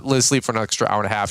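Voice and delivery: usual speaking voice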